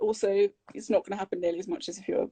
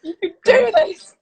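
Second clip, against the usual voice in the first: high, loud, slow, hammed-up cheer; over-the-top mirror pep talk; mock self pep talk; 11 semitones higher, 11 dB louder, slower than usual. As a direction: psych yourself up with a strained, drawn-out cry. Acting her pep talk to mirror.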